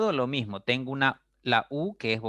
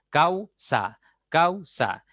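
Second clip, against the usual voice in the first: "Causa" raised, split cau, sa; syllable by syllable; 5 semitones higher, 4 dB louder, much slower than usual. Shown as a demonstration. Teacher showing joined vowels, "Causa" in two raised syllables. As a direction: keep cau in one high beat, then sa in "Causa". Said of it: "Tienen que ir juntas en la misma sílaba".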